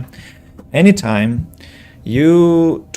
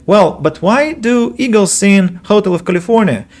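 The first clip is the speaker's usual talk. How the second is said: mock student question; 9 semitones higher, 3 dB louder than usual. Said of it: "And they're like".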